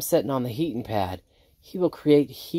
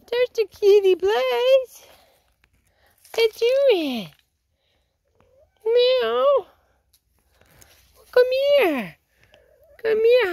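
pet talk; 6 dB louder, slower than usual. Real, not acted. Feeding oats to her chickens, very high cooing pet talk. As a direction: coo at them like they're babies.

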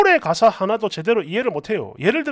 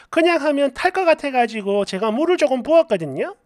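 plain reading voice

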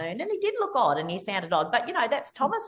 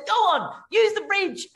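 much higher, louder eager voice of a stranger; a proud man gladly offering his bridge, higher and louder; excited stranger; 12 semitones higher, 4 dB louder than usual. Retold or imitated. Quoting excited stranger Shane.

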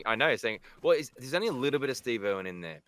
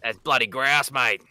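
louder, broad Australian bloke; Aussie character voice; 5 dB louder than usual. Pretend. Voicing his Australian game character, broad Aussie voice. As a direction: lay on the Aussie slang.

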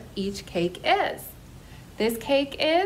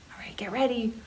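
whispering; 6 dB quieter, much faster than usual. Real, not acted. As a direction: whisper a playful get-ready. Hushed cue before group cheer.

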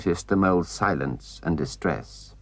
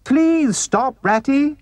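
very high, desperate little mole voice; high plea full of anguish; anguished pleading; 4 dB louder, slower than usual. A narrator's reading. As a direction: beg him to stop. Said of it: "pleaded the poor mole in anguish".

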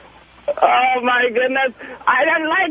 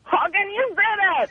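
high, softer protest; voice getting higher; 7 semitones higher, 3 dB quieter than usual. Real, not acted. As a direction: push the pitch up in indignation. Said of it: "your voice gets higher every time I say it".

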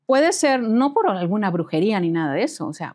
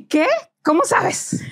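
startled disbelief; 10 semitones higher, slower than usual. Retold or imitated. Startled boyfriend.

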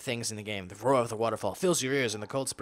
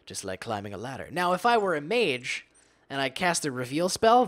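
ordinary talk, nothing marked

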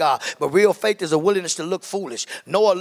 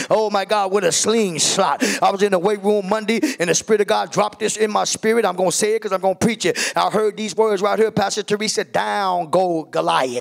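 loud, fired-up sermon climax; fervent revival preacher driving the message home; full-force preaching; 4 semitones higher, 4 dB louder than usual. Real, not acted. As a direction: preach it with rising fire, stretching out the battle cry.

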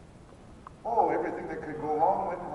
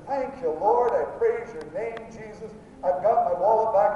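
loud, high shout of thanks; exuberant praise; 5 semitones higher, 9 dB louder than usual. Acted. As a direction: praise the Lord with full-out enthusiasm. Acts praise he should have given.

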